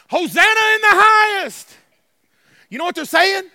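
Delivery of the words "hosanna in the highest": high, loud acclamation; exultant shout. Retold or imitated. Preacher voices Palm Sunday crowd, exultant shout. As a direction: cry it out like a crowd hailing its king. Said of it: "They were shouting".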